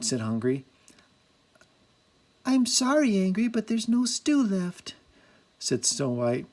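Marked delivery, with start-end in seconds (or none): 2.5-4.9 high, sweet apologetic voice. A narrator's reading. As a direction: say it kindly, softening the news.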